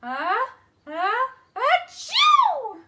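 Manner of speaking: slow building ah-ah into a loud high achoo; wildcat sneeze. Acted. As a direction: stretch it out then explode. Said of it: "and then I sneeze".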